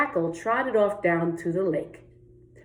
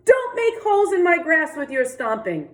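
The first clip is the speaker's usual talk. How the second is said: shout; 9 semitones higher than usual. Acted. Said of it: "Rabbit leapt in front of him and shouted".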